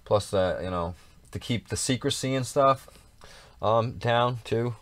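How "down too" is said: usual speaking voice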